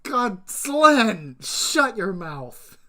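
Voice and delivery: mock outrage yelled at a friend; playful shout. Real, not acted. Streamer yelling at chatter Slen, loud playful outrage.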